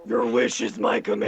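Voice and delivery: low genie pronouncement; deep mock-servile reply; wish-granter voice. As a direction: intone it like an obliging demon. Answers as a wish-granting demon.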